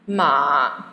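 low, dipping "ma"; third tone. Shown as a demonstration. Teacher running through four tones on one sound, "ma" low and dipping. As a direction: sink "ma" right down low. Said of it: "Now, M A".

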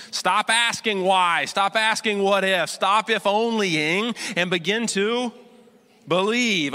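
loud, slow, pressing plea; preaching exhortation. Real, not acted. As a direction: hammer it home to the congregation. Preacher exhorts at full force.

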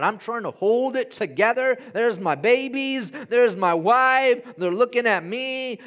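loud, high, worked-up retelling of panic; retold panic. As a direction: act out freaking out while holding it together. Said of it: "I'm freaking out".

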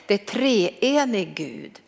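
ordinary delivery

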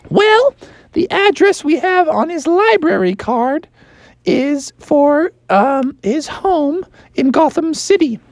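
high, shrill scolding librarian; hammed-up high librarian; fussy librarian. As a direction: shoo them out in disgust.

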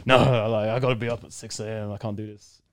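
a hint of a gruff coach refusing; bad coach impression. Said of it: "that's a bad imitation".

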